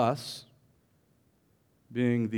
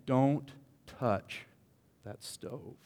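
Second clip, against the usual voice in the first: mother's warning; 6 dB quieter, much slower than usual. Retold or imitated. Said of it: "A mother saying".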